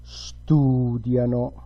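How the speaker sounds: slow "studiano", stressed on STU; retracted stress. Shown as a demonstration. Teacher showing where stress moves, "studiano" slowly stressed on STU. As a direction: draw out STU in "studiano", then light -diano. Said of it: "you retract the stress on the syllable that comes before".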